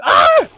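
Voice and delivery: sudden high yelp; mock-startled yelp; fake scream. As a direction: jump as if bitten, then drop it. Fake scare, then "just kidding.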